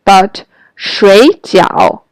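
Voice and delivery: 水饺 slow with dipping third tones; 水饺，第三声. Shown as a demonstration. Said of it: "have the third tone".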